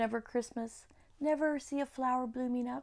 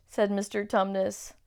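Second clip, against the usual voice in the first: ordinary delivery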